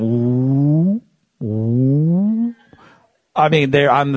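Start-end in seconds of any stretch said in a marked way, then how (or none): 0.0-2.5 mock onlookers. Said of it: "People were going".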